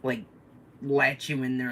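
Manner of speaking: ordinary talk, nothing marked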